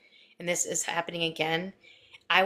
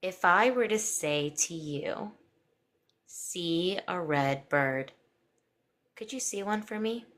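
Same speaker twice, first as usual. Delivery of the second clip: slow, calm guided imagery; hypnotic voice; slower than usual. Acted. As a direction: paint each image slowly.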